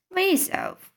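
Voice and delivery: mild, narrated cry jumping high; cried out. A narrator's reading. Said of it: "cried B F G".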